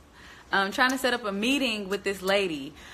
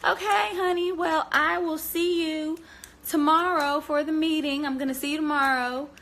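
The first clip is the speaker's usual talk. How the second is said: imitating the lady; 9 semitones higher than usual. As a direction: mimic the chatty coworker, sweet and high. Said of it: "she says".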